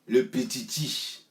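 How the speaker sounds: le petiti drawn out slow, ending on a rising questioning tune; mocking rise. Repeating the jibe at the little one, slow with a teasing rise. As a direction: let le petiti hang up in the air, teasing.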